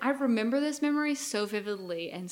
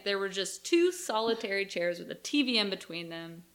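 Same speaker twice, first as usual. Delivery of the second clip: ordinary delivery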